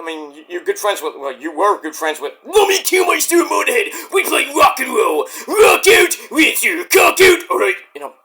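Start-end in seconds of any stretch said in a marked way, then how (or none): 2.4-7.4 rowdy rocker shout. Retold or imitated. Rock star impression.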